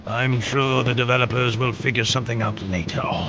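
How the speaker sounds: low-pitched immortal villain, unconcerned; deep, offhand villain dismissal; dismissive immortal. Acted. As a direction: brush it off in a deep voice.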